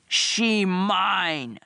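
drawn-out possessive monster cry; bellowing brute. A narrator's reading. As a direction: claim her like a lumbering brute. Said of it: "Quog bellowed".

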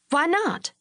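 high-pitched cross question; irritated challenge. A narrator's reading. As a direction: demand a reason, pitch jumping up. Angry wife challenges her husband.

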